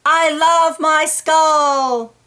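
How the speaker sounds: slow, loud, high proud cry; a child's joyful shout to the world; shouted declaration. Pretend. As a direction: shout it out to the whole world, slowly. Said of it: "Shout to the world".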